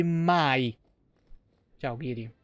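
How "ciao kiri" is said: ordinary talk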